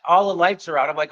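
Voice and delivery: usual speaking voice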